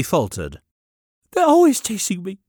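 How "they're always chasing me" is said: put-on high, eager child voice; young boy voice. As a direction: pipe it up like a small boy. Boy falters.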